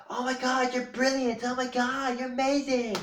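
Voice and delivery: very high-pitched gushing mum; squealed mock praise, no real volume; mock gushing shout. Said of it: "her mother will shout at her".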